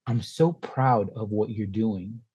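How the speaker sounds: soft, slow, tender praise; quiet heartfelt mom; tender mother. Retold or imitated. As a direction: say it gently, moved.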